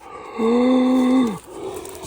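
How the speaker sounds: high, sudden gasp of shock; sharp gasp. Real, not acted. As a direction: gasp at an amazing find. Sudden discovery of a rare find.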